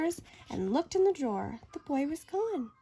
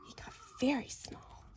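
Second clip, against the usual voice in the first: tiny hushed voice; whispered smallness; 10 dB quieter than usual. A narrator's reading. Narrator telling the boy shrinking, whispering.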